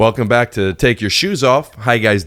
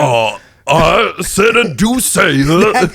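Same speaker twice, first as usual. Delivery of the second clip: slow southern drawl with gristle; southern gut voice; 4 semitones higher, much slower than usual. Pretend. Comedian shows his friend the southern Foghorn voice, slow and drawled. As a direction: drag it out like a gravel-throated southern rooster. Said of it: "the southern gut voice with that gristle".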